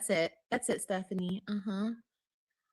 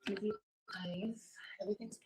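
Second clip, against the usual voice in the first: whisper; 5 dB quieter than usual. Real, not acted. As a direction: breathe the words, no voice at all. Baby napping, lights off, whispering.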